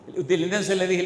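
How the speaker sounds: normal talking voice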